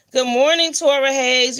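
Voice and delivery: cheerful live shout-out; upbeat greeting. Real, not acted. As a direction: call out the welcome brightly. Greeting live stream viewer.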